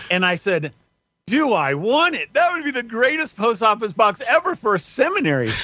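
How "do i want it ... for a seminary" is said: high-pitched, gleeful enthusiasm; delighted retort. Retold or imitated. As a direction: exclaim it like you've won a prize, pitch way up. Retelling his delighted answer to clerk.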